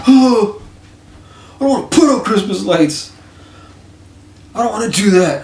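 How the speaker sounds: put-on high, loud, sulky kid whine; whiny little kid. Imitating a fifty-one-year-old friend whining like a sulking child. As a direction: whine it like a kid who won't do chores. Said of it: "like a little kid kicking the floor".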